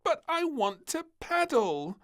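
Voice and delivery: put-on a furious child objecting; cross protest. A narrator's reading. Princess protests being taken from the pond, cross whine. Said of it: "The little princess was furious".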